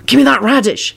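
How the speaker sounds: high, urgent demand; desperate Harry. A narrator's reading. Harry begs Luna for the radish, desperately. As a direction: plead for it urgently. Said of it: "he said desperately".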